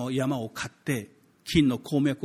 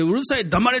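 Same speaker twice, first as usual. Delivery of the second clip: louder, high-pitched mock telling-off; mock scolding; 7 semitones higher, 6 dB louder than usual. Crowd hushing the blind man louder, mock scolding. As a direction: play the crowd shutting him up. Crowd silencing him once more.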